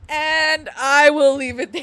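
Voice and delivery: slow, loud, laughing through it. Real, not acted. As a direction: hold back a spoiler, cracking up. Laughing while teasing a spoiler.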